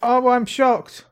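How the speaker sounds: slow, dry fake shock; drawn-out mock surprise; sarcastic. Sarcastic reaction to obvious news.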